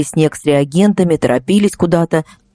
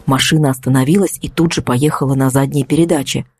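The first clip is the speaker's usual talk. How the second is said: ordinary delivery, nothing marked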